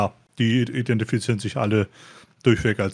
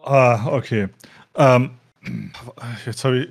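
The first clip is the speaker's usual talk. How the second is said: ordinary talk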